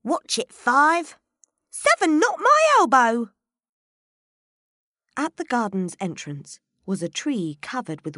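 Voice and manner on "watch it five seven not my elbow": high, loud squabbling gardener voice; a shrill little gardener's scolding. Acted. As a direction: snap at your clumsy fellow gardener. Gardeners squabbling while painting roses.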